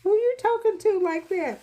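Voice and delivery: retold voice scolding her tone; mock indignation. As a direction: squeal it in mock outrage. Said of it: "I'm like".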